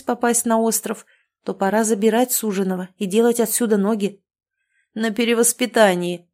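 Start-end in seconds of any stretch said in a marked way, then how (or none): none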